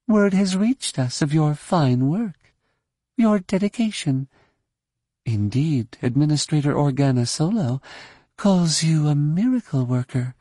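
courtly, ingratiating lilt; flattering Baroness. A narrator's reading. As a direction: pile on praise as a grand lady. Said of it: "piling flattery on a subject".

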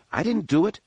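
high, quick protest of innocence; a boy protesting his innocence to a cross Wookiee; exclaiming. Acted. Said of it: "Jason exclaimed".

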